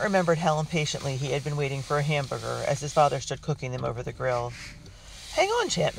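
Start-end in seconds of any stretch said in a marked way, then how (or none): 5.3-6.0 playful scold, pitched much higher; teasing chide. Acted. Father teases his impatient son at the grill, chiding. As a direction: gently chide the impatient boy. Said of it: "Mister Carlton had chided".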